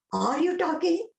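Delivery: high-pitched incredulous questioning; astonished disbelief. Retold or imitated. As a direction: ask in amazed disbelief, voice lifted high. Acted astonished reply to Swami.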